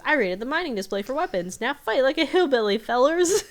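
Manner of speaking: put-on old hillbilly rallying the group, rising to a laughing holler. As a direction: ham up an old hillbilly calling to arms, cracking up at the end. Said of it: "and he goes".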